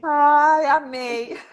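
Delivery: real happy exclamation; delighted. Real, not acted. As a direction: gush with delight at the coffee. Host delighted by guest's coffee.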